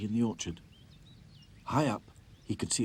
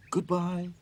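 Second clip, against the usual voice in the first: calling goodbye; 9 semitones higher, 5 dB louder than usual. A narrator's reading. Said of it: "called the raggy dolls".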